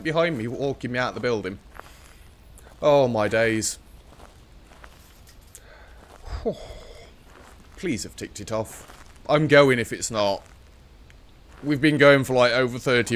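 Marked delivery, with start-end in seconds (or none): 6.2-6.9 weary sigh. Real, not acted.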